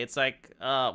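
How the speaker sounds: ordinary delivery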